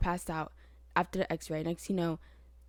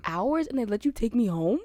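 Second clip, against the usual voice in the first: retold shock; 5 semitones higher, 8 dB louder than usual. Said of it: "And I said".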